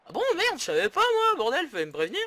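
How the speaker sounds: high squeaky faked surprise; mock surprised players. Mocking his players who forgot the semi-final, high and loud. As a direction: play a clueless footballer just waking up.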